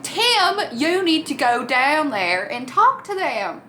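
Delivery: slow lofty command with the name sung out; Parker Posey impression. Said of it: "Parker Posey's like".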